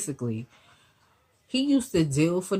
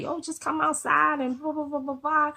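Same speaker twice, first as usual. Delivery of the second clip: lightly high, teasing imitation of the homeboys; mock friends calling; 11 semitones higher than usual. Girlfriend imitating his friends calling him outside, light high mock voice.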